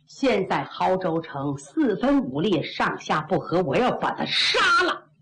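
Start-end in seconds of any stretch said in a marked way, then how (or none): none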